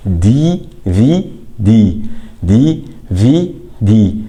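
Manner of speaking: دي في دي very slow, each letter stretched apart; slow, separated دي في دي, said for the ear; consonant sound shown. Shown as a demonstration. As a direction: sound out دي في دي with a clear "d" onset. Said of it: "لأن النطق حرف في ساكن".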